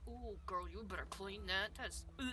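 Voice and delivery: soft disgusted aside. Acted.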